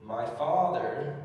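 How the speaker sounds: slow, drawn-out reading of "My Father". Shown as a demonstration. Preacher reads the verse aloud slowly, stretching it out. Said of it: "So I read over".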